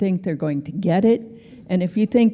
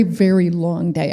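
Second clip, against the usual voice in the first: ordinary delivery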